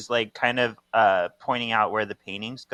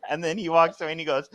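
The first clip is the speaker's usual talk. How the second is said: ordinary talk, nothing marked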